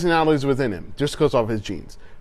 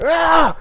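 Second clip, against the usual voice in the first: high, loud mock rage cry; short acted scream of rage; mock She-Hulk roar; 14 semitones higher, 5 dB louder than usual. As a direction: let out a hammed-up transformation yell. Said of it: "she's like".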